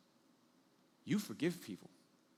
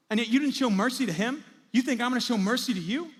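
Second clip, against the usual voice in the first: high, loud indignant questions; scolding master at full volume; outraged rebuke; 8 semitones higher, 8 dB louder than usual. Acted. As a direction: confront him, voice raised throughout. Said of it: "You wicked servant".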